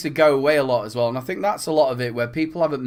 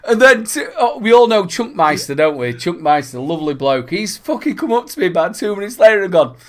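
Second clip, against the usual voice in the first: normal talking voice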